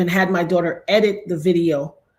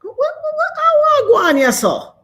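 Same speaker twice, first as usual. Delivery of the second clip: much higher, louder shocked outcry; shocked exclaim; 6 dB louder than usual. Real, not acted. Something pushing her off stream, shocked cry. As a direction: shriek in alarm then recover.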